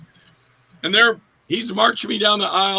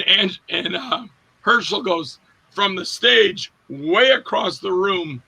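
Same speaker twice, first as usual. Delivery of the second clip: ordinary talk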